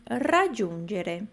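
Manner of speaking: "raggiungere" slow, rolled r and dj sounds; trilled R. Shown as a demonstration. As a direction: model "raggiungere" with a clean trill. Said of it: "vai ser sempre assim".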